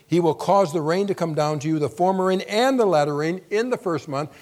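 loud, high proclaiming of scripture; proclaimed scripture. Acted. Preacher reading Joel to the congregation, loud and proclaiming. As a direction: proclaim it to the hall.

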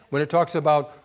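normal talking voice

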